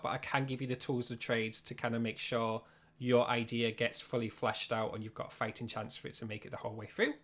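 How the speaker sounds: ordinary talk, nothing marked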